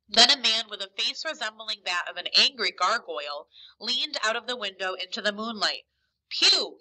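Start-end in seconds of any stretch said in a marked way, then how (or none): 6.3-6.8 high, louder shout of a name; narrated shout, pitch jumping up; shouted call. A narrator's reading. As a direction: call out to the leader sharply. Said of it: "he shouted".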